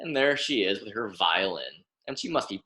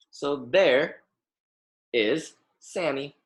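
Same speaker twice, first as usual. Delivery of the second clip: ordinary delivery, nothing marked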